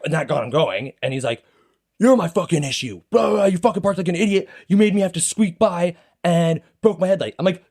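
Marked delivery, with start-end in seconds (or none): 2.0-7.4 high, ranting imitation of an irate driver; acted anger. Angry driver blaming the man who parked, acted anger. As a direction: hurl the blame in mock outrage. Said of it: "he went from super angry".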